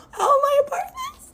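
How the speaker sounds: very high, squeaky mock-crying wail; tearful protest. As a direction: whine it fast, as if about to cry. Protesting being dragged into fight.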